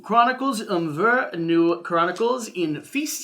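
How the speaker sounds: slow, loud fake German accent on the title; mock German reading. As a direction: butcher the German text with a heavy put-on accent. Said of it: "Do you know how to read German?".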